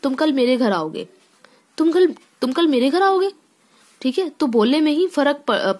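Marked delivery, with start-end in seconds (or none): 1.8-3.3 surprised. Shown as a demonstration. Teacher demonstrating surprise to students, higher astonished repetition. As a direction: repeat it in disbelief. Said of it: "एक बोलने में ही अलग तरीका आ रहा है".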